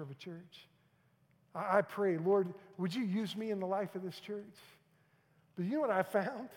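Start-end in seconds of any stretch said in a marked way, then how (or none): 1.5-4.6 loud, high pulpit voice; full-force sermon delivery; preaching. Acted. 5.6-6.5 loud rising pulpit question; preaching question. Acted. Rhetorical question to congregation.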